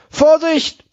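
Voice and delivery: sharp high warning shout. Acted. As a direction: yell it as a warning. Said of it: "ruft der Führer". (lauter Warnruf)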